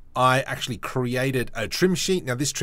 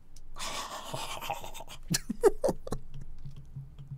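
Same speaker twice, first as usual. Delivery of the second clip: a long, airy, high-pitched giggle; high breathy giggle; 6 dB quieter than usual. Real, not acted. To viewers, tickled by his own decal, in a high airy giggle. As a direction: let a high giggle escape without voice. After making a clean decal.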